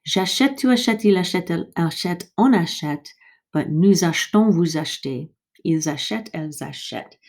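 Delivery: J'achète to elles achètent in a brisk recap; quick run-through. Shown as a demonstration. Teacher recapping for students to repeat, brisk model. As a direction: rattle through the forms. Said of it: "Try repeating them".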